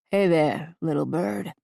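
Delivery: a light touch of Brooklyn in the greeting; understated Brooklyn accent; hint of Brooklyn accent. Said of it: "with a hint of accent that sounds almost Brooklyn".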